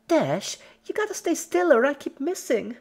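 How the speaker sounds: high, loud, chirpy scolding; scolding Pinky. Acted. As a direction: scold her like a cheerful child. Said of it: "scolded Pinky".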